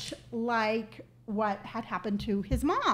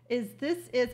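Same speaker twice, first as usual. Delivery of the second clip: ordinary talk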